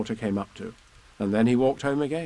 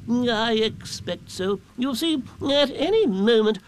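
high, slow, ponderous owl; Owl, pompous; 11 semitones higher, 3 dB louder, slower than usual. A narrator's reading. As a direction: draw it out as a learned owl. Owl answers, resumes forecast.

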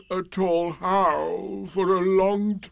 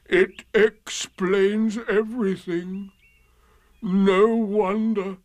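slow, higher mournful drone; Eeyore, gloomy; 8 semitones higher, slower than usual. A narrator's reading. Donkey accepting his loss, slow and gloomy. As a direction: drag each word gloomily. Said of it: "said Eeyore gloomily".

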